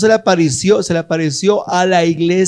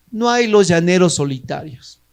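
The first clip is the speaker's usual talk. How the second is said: usual speaking voice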